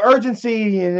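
ordinary delivery

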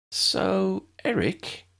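high, tentative opener; tentative venture. A narrator's reading. Lydia raising Eric privately with Tessa, tentative. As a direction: venture it carefully, pitched well up. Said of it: "Lydia ventured".

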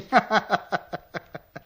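a sly, knowing laugh; knowing chuckle. Acted. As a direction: chuckle as if you know their secret. Stranger laughs at the couple.

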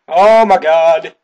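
loud, high, drawn-out exclamation; shocked yell. Real, not acted. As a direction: cry it out slowly in shock. Shock during game chase.

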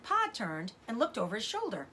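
usual speaking voice

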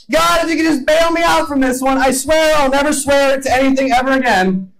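high, pleading bargain with God; panicked plea. Retold or imitated. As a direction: beg desperately to be bailed out. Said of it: "you're freaking out like".

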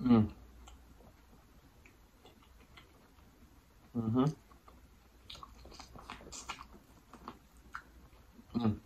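mumbling while eating; chewing. Real, not acted. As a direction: mouth full of corn. Seen 0: Lips closed, working food after biting corn; cob in hand.

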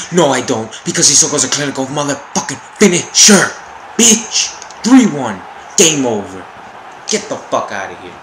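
loud, fast, high gloating yells; real triumphant yell; triumphant shouting. Real, not acted. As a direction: roar it like the game is won. Celebrating a goal.

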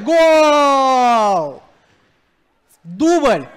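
loud drawn-out goal call; goal shout. Real, not acted. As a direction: roar the goal. Goal and double called. (громкий крик «гол»)

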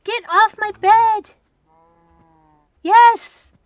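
high, loud and thrilled; excited shriek. Real, not acted. Gamer thrilled a cow left, excited shriek. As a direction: squeal it out in joy. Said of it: "I got so excited for a second there".

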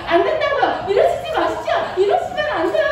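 high-pitched protest as Joseph; flustered refusal. Acted. Joseph refuses her again. (높은 목소리로 당황한 거절)

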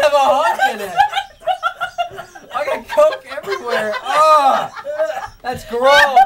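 half laughing, half crying; relieved giggling through disgust. Real, not acted. Relief after fake dog food.

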